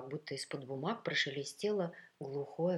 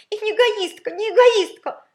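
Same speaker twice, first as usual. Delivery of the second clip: very high, loud, wailing protest; squealed, tearful denial; nearly crying; 19 dB louder than usual. A narrator's reading. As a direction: protest like a spoiled child on the verge of tears. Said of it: "Капризуля чуть не плакала".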